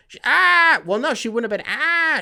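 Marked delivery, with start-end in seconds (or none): 0.1-0.8 much higher, louder startled "ah!"; mock scream. Mock flashbang reaction. 1.7-2.2 mock scream. Mock flashbang reaction.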